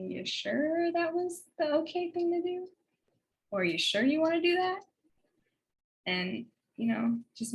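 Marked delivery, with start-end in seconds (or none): 0.1-4.8 high, questioning lilt; gently doubting mentor. Retold or imitated. Mentor asks her to reconsider, doubtful and questioning. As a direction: imitate a mentor prompting second thoughts. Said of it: "that look on her face to say".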